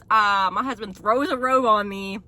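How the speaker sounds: normal talking voice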